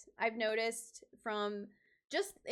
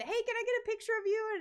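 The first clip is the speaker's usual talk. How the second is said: higher, quick eager request; eager fan; 11 semitones higher, faster than usual. Retold or imitated. Fan stepping back asking for a photo, eager. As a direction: ask brightly like a shy fan. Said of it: "a step back and".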